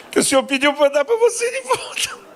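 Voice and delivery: voz muito aguda e esganiçada, sem jeito; oração ridícula. Retold or imitated. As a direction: reze se sentindo ridículo, com falsete. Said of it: "Eu me sentia ridículo". (pastor orando sem jeito, em falsete constrangido)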